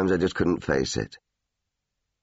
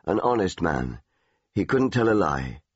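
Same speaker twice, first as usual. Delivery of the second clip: ordinary delivery, nothing marked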